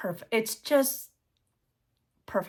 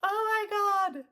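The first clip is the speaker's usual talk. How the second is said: awed; 10 semitones higher, 6 dB quieter than usual. Real, not acted. Overwhelmed after reliving the moment.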